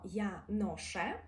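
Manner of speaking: «я ноше» slowly with a hard ш; teacher shows the hard ш; hard Polish sounds. Shown as a demonstration. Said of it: "Ми твердо говоримо".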